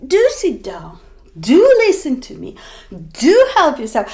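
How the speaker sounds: "do" hit on each line; insistent host, leaning on "do"; emphatic do. Shown as a demonstration. Insistent host urging a guest, stressed "do". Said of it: "if you want to emphasize it".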